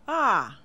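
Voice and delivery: "A" falling sharply from high to low; fourth tone. Shown as a demonstration. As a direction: drop "A" hard from the top. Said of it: "falls from high to low, like this".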